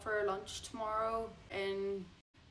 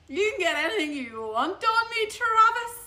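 loud, high, hammed-up swooning offer; exaggerated eager gushing, drawing out the name; over-eager; 10 semitones higher, 7 dB louder than usual. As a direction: belt it out high, comically over-keen. Retelling her over-eager reply to Travis.

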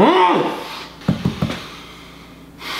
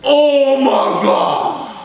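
drawn-out groan; 6 semitones higher, much slower than usual. Real, not acted. Lemon juice sourness.

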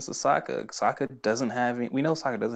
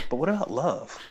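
higher-pitched, lightly acted eagerness; eager question; 5 semitones higher than usual. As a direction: imitate a kid changing the subject hopefully. Acting Aang's eager question.

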